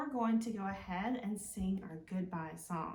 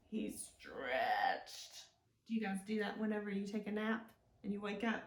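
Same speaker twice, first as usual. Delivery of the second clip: ordinary talk, nothing marked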